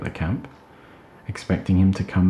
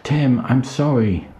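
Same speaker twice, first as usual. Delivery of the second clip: higher, slow calling shout; shouted apology; 7 semitones higher, slower than usual. Acted. Emma shouting over the wind to Tim, slow calling. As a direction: call the apology out, slowly. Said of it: "shouted Emma".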